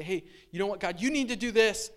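bossy tone, telling God what to do; demanding. Retold or imitated. Disciples bossing God around, demanding. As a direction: push it like an order to God.

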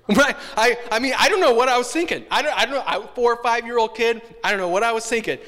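real laughter, high and loud; amused disbelief at your younger self; laughing. Real, not acted. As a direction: laugh through it at yourself. Laughs at own childhood story.

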